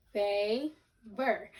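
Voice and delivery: "Favor" split into "fay" and "ver"; two separate syllables. Shown as a demonstration. Teacher repeating the split for pupils, syllable by syllable. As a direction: pause in the middle of "Favor". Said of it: "break down favor into two syllables".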